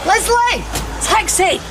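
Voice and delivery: shouting upstairs to hurry someone; calling out. Acted. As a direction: yell for her to hurry down.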